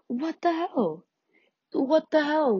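high, incredulous what the hell; confused disbelief in class; bewildered. Retold or imitated.

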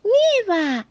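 high, joyful cry; excited exclamation. Acted. The little rabbit seeing snow falling, exclaiming. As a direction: burst out at the first snow. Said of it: "exclamó el conejito".

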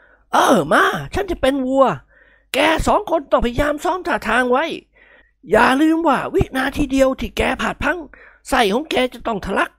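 put-on scare the boys with relish; old nobleman warning. A narrator's reading.

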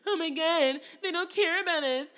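high, loud, sobbing mock lament; mock crying wail. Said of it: "and you guys are gonna".

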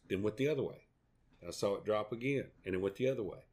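normal talking voice

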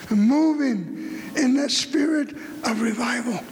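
normal talking voice

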